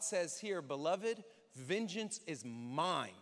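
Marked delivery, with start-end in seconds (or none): none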